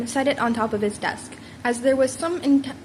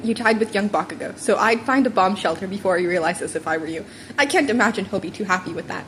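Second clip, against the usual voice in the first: ordinary delivery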